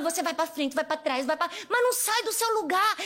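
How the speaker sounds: normal talking voice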